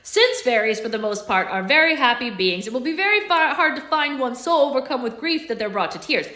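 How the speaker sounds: Alex reading the journal's instructions, formal and loud; journal read aloud. Acted. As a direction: read it like an old guidebook.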